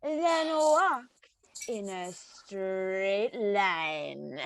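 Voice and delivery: put-on slurred, slowing drunk talk. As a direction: talk like you've had too many. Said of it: "you're drunk".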